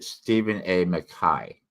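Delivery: McKay ending like "sky", without a Scottish accent; the bearer's pronunciation. Shown as a demonstration. Reviewer showing how the author says his name, McKay as "muh-KAI". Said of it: "he pronounced it".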